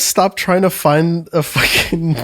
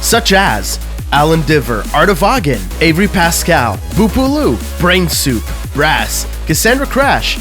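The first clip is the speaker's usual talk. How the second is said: upbeat podcast outro; outro read; 2 semitones lower, slower than usual. Acted. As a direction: read the credits brightly. Patron credits outro read.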